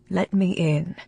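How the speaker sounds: a hint of a man coaxing through a door; tender Landon. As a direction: gently coax her to open up, quietly. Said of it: "his tender inflection".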